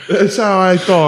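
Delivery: high, put-on Irish accent; Colin Farrell. Joking as the actor about his speech, mock Irish accent. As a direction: defend your hammed-up Irish brogue. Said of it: "I'm Irish".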